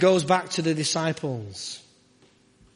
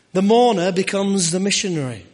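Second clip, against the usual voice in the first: proclaimed; 4 semitones higher, 7 dB louder than usual. Acted. Sermon climax line, repeated softly.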